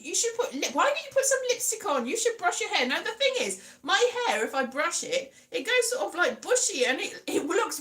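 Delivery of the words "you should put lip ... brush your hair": lightly imitated nagging mum; higher-pitched, hurried motherly advice. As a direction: mimic a mum fussing. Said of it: "my mum's always like that".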